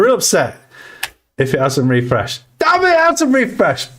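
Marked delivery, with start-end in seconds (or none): 2.6-3.9 hammed-up, high-pitched cry of dismay; mock frustrated outburst. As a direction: wail it in mock outrage. Mock outburst over follower count.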